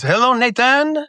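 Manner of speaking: put-on high, slow French-accented caller; singsong French phone greeting. The dive company fella phones the brothers, French accent. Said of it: "very strong French accents there".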